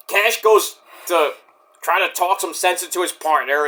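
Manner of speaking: ordinary talk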